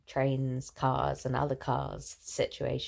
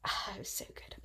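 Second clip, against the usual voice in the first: whispering; 12 dB quieter than usual. Real, not acted. Lying in bed, fond recollection.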